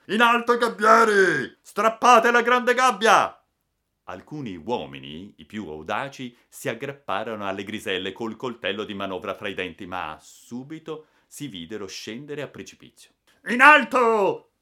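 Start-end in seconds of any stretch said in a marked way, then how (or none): 0.1-3.4 high, booming command to the crew; thundering shout. A narrator's reading. Captain orders men up the mainmast, thundering. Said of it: "si lanciò in mezzo al ponte tuonando". 13.5-14.5 high, drawn-out roar of command; menacing shout. A narrator's reading. Captain repeats his order to the crew, menacing roar. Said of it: "ripeté il capitano con voce minacciosa".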